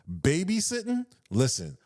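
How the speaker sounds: ordinary talk, nothing marked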